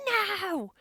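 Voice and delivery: high, mock whiny refusal; protesting kid. Mom imitating son refusing climbing club, protesting. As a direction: imitate a kid digging in his heels.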